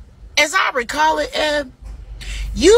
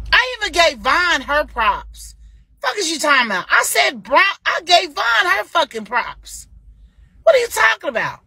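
indignant; 7 semitones higher than usual. Real, not acted. Indignant rebuttal about giving props.

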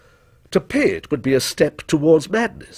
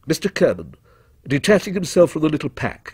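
ordinary delivery

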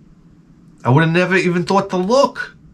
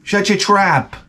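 higher-pitched dismissive order; hammed-up heckler's put-down; mock bullying; 6 semitones higher, slower than usual. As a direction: cut off the pleading man. Tormentor silencing the coughing man.

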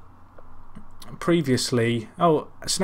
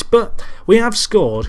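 higher, louder, stretched-out announcement; excited goal announcement; 7 semitones higher, 6 dB louder, slower than usual. Real, not acted. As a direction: break the news with a burst of excitement.

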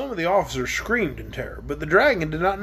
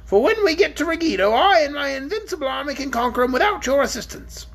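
haughty queen; 8 semitones higher than usual. Acted. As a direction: look down your nose at the dragon. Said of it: "in her most haughty manner".

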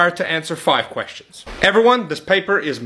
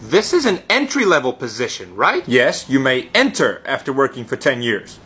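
normal talking voice

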